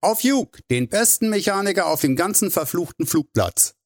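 ceremonious, high-pitched tribute; solemn toast. A narrator's reading. Drew raises his beer mug to his mechanic, solemnly. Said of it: "verkündete Drew feierlich und hob seinen Bierkrug".

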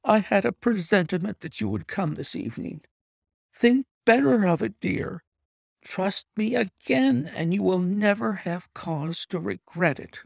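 a wife coaxing her husband to trust her; lightly voiced pleading woman. A narrator's reading. Wife's pleading speech voiced.

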